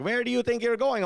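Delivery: put-on high, challenging Indian-accented parent; mock an Indian parent's demand. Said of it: "Indian parents, they're just all like".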